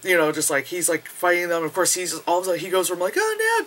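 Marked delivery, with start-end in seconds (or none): 3.2-3.6 high-pitched mock panic; mock alarm. Fighter caught all of a sudden, mock alarm. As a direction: squeak it in sudden pretend alarm.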